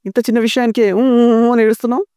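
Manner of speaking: high imitated whimper; mock whimpering. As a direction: whimper like a crybaby to tease.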